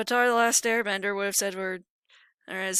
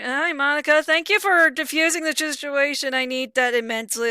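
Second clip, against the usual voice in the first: higher and louder, slowed thanks; relieved greeting; 5 semitones higher, 7 dB louder than usual. Acted. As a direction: gush with relief at her rescue.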